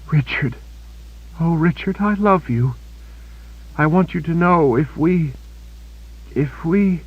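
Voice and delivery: wounded Kaelin telling Richard she loves him; dying young woman. A narrator's reading. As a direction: say goodbye through pain, breaking off. Dying Kaelin confesses love.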